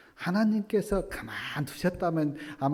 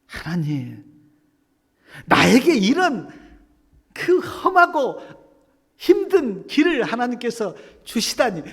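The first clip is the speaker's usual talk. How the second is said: much higher, louder, indignant whine; complaining to God; 8 semitones higher, 8 dB louder than usual. Retold or imitated. Believer grumbling to God about a hard path, loud indignant whine. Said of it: "불평 안 하셨습니까?".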